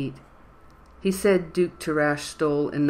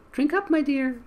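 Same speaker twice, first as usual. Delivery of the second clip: much higher, projected granny voice; louder old woman voice; 12 semitones higher than usual. A narrator's reading. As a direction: call out as the frail old lady. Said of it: "he said louder".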